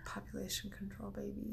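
hushed conspiratorial whisper. Acted. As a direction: whisper it like a secret. Joking conspiracy line.

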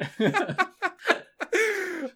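long high laugh; high drawn-out laughter; real laugh. Real, not acted. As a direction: laugh hard at your own joke. Laughs at his own joke.

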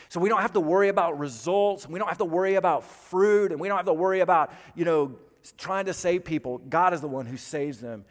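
loud, rising preacher's climax; fervent sermon peak; preaching at full force. Acted. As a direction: drive it home to the church.

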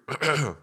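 real wet cough into mic; chesty cough. Real, not acted. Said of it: "sorry for coughing in the mic".

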